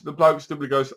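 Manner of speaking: normal talking voice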